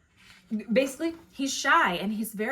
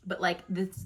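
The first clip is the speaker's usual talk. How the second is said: usual speaking voice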